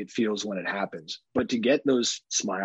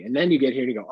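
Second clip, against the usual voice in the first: normal talking voice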